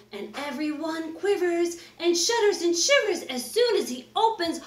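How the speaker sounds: plain reading voice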